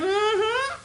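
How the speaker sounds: high put-on hum; mocked dismissive mm-hmm. Manager answering the caller's question, mocking high mm-hmm. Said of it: "She started with the mm-hmm already".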